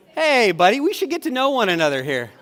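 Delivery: loud, high hail to a buddy; playful calling out. Pretend. Preacher jokingly hailing an audience member, loud high call.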